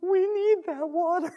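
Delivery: high, put-on cry of complaint; mock protest. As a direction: imitate dismayed onlookers in a high voice. Voices onlookers protesting wasted water.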